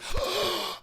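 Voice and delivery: sharp high gasp of delight; surprised gasp. Shown as a demonstration. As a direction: gasp like you just spotted him. Mock surprise at spotting friend.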